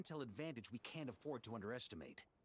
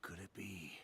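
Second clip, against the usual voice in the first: lower, breathless whisper of realisation; awed whisper; 5 semitones lower than usual. Acted. Hero realising who gazed back, in a low whisper. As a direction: murmur it as the truth dawns.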